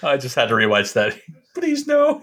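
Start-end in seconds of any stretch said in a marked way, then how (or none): 1.5-2.2 high-pitched mock plea; imitated pitiful begging, pitched way up; pleading movie character. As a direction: squeak it out like a helpless stoner. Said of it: "poor guy number three says".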